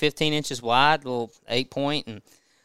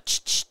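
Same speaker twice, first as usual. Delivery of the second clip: short spray-can hiss noise; imitated spray squirts; 4 dB quieter than usual. Dad showing son how to spray boots, imitated squirts. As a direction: mimic two quick squirts.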